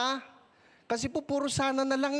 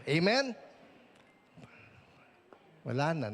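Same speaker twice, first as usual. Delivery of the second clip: ordinary talk, nothing marked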